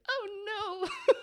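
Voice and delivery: high-pitched, squeaky "oh no" played for laughs; hammed-up little wail of dread; mock dismay. Acted dismay at breakup call.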